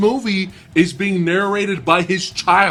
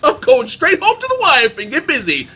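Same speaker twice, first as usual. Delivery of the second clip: much higher, eager, mock-excited; sudden horny hero; 4 dB louder than usual. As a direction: hero flipping from sympathy to lust. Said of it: "he turns around and he goes".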